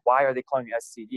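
fast, insistent repeat of the question; mom repeating. Retold or imitated. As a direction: rattle the question off quickly, pressing for an answer. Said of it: "And she's like".

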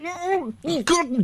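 muffled high choking hums. Retold or imitated. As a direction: hum through a blocked throat. Choking woman voiced.

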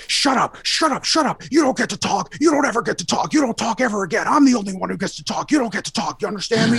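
high-pitched mock tirade of a raving man; imitated yelling. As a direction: lay into someone, high and frantic, mimicking him. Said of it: "just yelling and blathering and laying into me".

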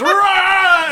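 loud, high-pitched mock roar; hammed-up battle roar; imitated roar. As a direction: roar like an over-the-top movie freakout. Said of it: "and then goes".

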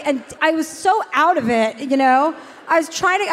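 ordinary talk, nothing marked

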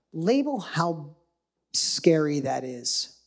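very slow, each word drawn out; slow it right down so it sinks in; deliberately slowed. Shown as a demonstration. Coach slowing down for the live chat, very slow. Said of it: "I'll slow it on purpose".

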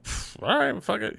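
high, airy, resigned voice; mock shrug. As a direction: toss it off like giving up. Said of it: "I'm like".

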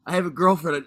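hint of an acted bro protest; straight-guy voice. Fending off the role-play partner, lightly put-on straight-guy voice. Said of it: "do it in your straight voice".

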